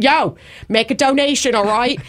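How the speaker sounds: hammed-up Philly Delco street accent. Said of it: "my, my Delco accent".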